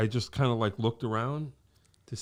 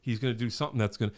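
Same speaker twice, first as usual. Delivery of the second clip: rushed anxious inner voice; 2 semitones higher, much faster than usual. Retold or imitated. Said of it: "you're like".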